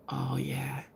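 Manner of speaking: soft unvoiced sarcastic aside; mock him under your breath; whispered eye-roll. Real, not acted.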